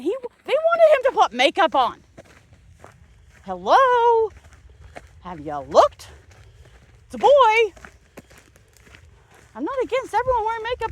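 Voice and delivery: loud, high indignant rant; can you believe this, outraged. Real, not acted. Outraged rant about makeup demand.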